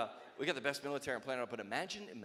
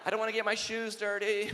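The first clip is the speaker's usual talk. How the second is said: put-on whiny young recruit; 8 semitones higher, 8 dB louder than usual.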